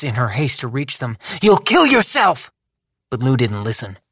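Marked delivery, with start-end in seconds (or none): 1.4-2.5 urgent warning. A narrator's reading. Labelle warns urgently.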